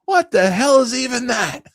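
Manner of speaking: very high, loud, slow mock outrage; drawn-out high yell from a clip; old man imitation. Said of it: "The guy goes".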